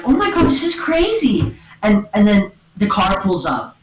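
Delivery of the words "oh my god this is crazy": amazed quote. Retold or imitated. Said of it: "and he's like".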